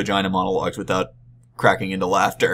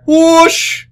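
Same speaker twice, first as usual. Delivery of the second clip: a loud, high whooshing swoosh; whoosh sound effect; 10 dB louder than usual. Retold or imitated. Fan in the Nylark shirt heading off, loud high whoosh. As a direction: blast a whoosh like something zooming off. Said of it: "he would echo it back".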